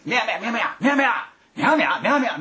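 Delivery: playful, lifted Nagoya-style meow chatter; cheerful meowing. Shown as a demonstration. Host showing audience how to play radio drama, cheerful. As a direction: show them how to meow merrily. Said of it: "楽しげに".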